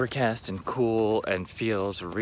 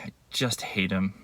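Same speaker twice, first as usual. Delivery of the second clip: ordinary talk, nothing marked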